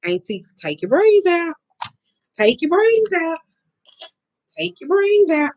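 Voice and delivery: high, drawn-out pleading niece voice; stretched, high-pitched mock nagging; imitating niece. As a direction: mimic a niece nagging her auntie in a high sing-out. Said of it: "my niece have been telling me".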